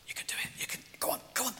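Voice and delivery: whispered encouragement; whispered prompt. Real, not acted. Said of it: "He says".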